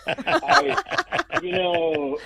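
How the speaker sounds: high laugh breaking through the words; real laugh that spills into the words; laughing at his own coffee joke. Real, not acted.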